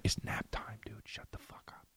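imitating an annoyed classmate whispering; whispered telling-off. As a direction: hush them in an irritated whisper. Classmate hushes them during nap time.

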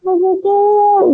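high, slow, tearful wail mimicked; mother finding the test, mock weeping; imitating crying mom. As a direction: draw it out like mom sobbing with joy. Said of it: "Y llorando".

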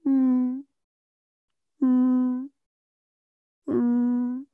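high, drawn-out mimicked dog whimpers. Passerby hears the hurt dog, imitated whimper. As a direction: whimper like a dog lying on a nail. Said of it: "they hear the dog go".

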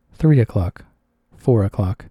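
ordinary delivery

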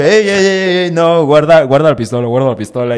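alto y fuerte, urgente; jefe alarmado. Retold or imitated. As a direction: frena al escolta con prisa. Jefe pidiendo guardar el arma. (el jefe le grita al escolta que guarde la pistola)

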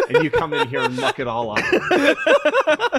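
high, cracking with laughter; mock outrage through laughing; blaming a teammate while laughing; laughing exasperation. Real, not acted. Exasperated at fellow player.

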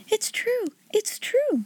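high, soft, breathless joy; a girl bursting with wonder to her friend; a little girl telling Becky the magic is real; joyful cry. Acted. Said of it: "she cried".

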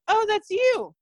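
high-pitched, imitated happy realization; light up as you recognize someone; delighted recognition. Retold delighted recognition, face lit up.

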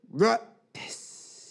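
high, loose retching noise; mock barf sound; mimicked vomiting. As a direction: imitate someone throwing up behind you. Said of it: "then I hear behind me".

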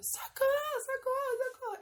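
high, loud cries for help; retold scream for help; mock cry for help. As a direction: yell for help over and over. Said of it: "Aí eu comecei a gritar".

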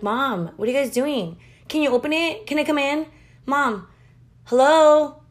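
put-on high child voice building to a loud call; impatient kids at the door, rising to a loud hello; whiny kids. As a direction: pester mom through the door, then yell hello. Kids banging on locked door.

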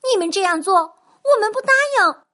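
high, loud firm refusal; loud protest. Acted. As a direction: stand up to the developers. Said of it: "冲着开发商们大声地说".

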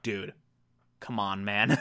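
low, soft deadpan plea; quiet reproach. Retold or imitated. Eleanor to spiralling Chidi, quiet low reproach. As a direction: drop low and soft, imitating a friend's appeal.

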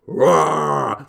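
a growling monster roar. Acted. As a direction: roar like a wild beast. Acting out Wolverine's charge.

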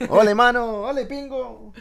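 put-on laughing caricature of a Colombian regional accent; mock local accent. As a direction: squeal it out as an over-the-top regional caricature. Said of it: "usted sí puede hacer el acento".

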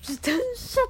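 high, odd head-voice tone. Real, not acted. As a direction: pitch it up into the head. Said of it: "どこから声出た？".

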